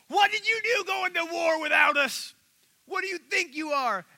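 high furious yelling; angry shout. Retold or imitated. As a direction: bellow it like an offended mob. Angry tribe confronts Gideon.

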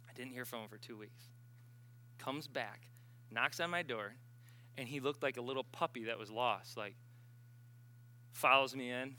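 ordinary talk, nothing marked

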